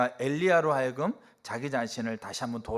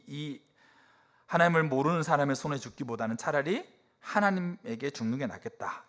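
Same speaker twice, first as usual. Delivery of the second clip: ordinary talk, nothing marked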